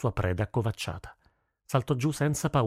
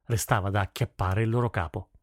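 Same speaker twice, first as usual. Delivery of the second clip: plain reading voice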